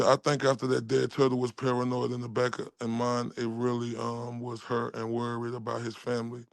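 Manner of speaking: low, quiet monotone reading. Retold or imitated. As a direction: read it softly on one low note. Reads out a written message.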